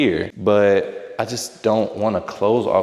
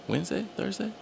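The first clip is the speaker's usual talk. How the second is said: mock disbelief; 12 semitones higher, 8 dB quieter, faster than usual. Mock-questioning the photographers' day.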